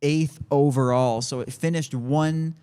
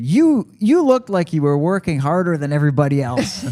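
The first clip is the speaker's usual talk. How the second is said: quoted volunteer; 4 semitones higher, 5 dB louder than usual. Retold or imitated. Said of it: "she said".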